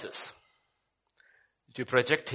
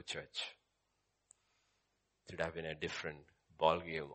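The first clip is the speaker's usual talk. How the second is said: lower, much softer musing; quiet reflection; 9 semitones lower, 13 dB quieter than usual. Real, not acted. Preacher muses softly to church about Telugu crowd. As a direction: draw them in with a hush.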